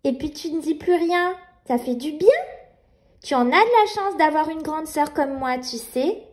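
put-on little girl voice. Nina soothing baby.

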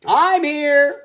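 high, loud duck voice. A narrator's reading. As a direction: quack it out as a duck.

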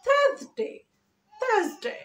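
THURSDAY as high "THAAZ-day"; smart pronunciation. Shown as a demonstration. Teacher showing silent r and z, high smart pronunciation. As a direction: drop the r in থার্সডে, z for s, high then falling. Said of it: "আরটাকে উচ্চ রাখতে হবে".

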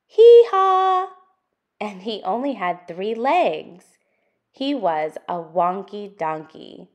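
lively, sing-song picture book reading; reading to children. Acted. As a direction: read it out for little listeners, building the list. Reads the picture book aloud.

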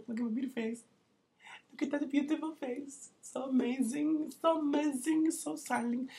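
high, preening falsetto admiring himself; mock vain falsetto. Vlogger to camera, admiring his own face, hammed-up high falsetto. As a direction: gush over your own face in a silly high voice.

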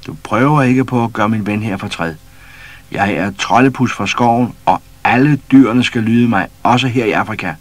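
loud, proud proclamation; bold commanding. A narrator's reading. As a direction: lay down the law to the lion. Pus commands the lion.

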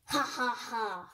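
slow villain cackle; mad scientist laugh. Acted. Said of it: "only mad scientists laugh like that".

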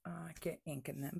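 normal talking voice

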